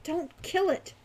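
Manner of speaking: urging. A narrator's reading. Falcon stopping Hawk from killing, urging. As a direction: urge your friend to hold back. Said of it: "urged Falcon".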